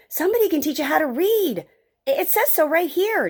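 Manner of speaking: put-on high, eager young Joey; excited boy. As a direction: share an exciting discovery in a high voice. Boy tells grandfather his discovery.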